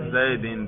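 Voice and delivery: زیدن said as zaidin, ending in -in; genitive ending. Shown as a demonstration. Teacher showing students the jarr case, zaidin with -in. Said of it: "جر پہ تلفظ کرو".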